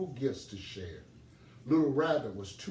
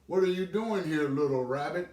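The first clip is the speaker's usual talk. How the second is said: higher, louder croaking call; a pond chorus hailing him; frogs calling; 5 semitones higher, 6 dB louder than usual. Acted. The frogs call to Little Rabbit, higher and louder.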